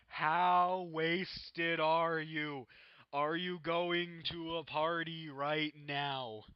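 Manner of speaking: loud, slow, put-on prank caller voice; booming slowed prank call voice; prank caller mock interrogation. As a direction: drag it out loud like a drunk interrogator. Prank call, friend acting drunk.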